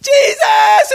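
high, loud cry of panic; panicked shout. Retold or imitated. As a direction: yell the name in sheer fright. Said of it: "And so I just go".

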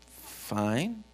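small, awkward, drawn-back answer; hesitant reply. Retold or imitated. Pastor awkwardly answering a stranger about his truck, hesitant.

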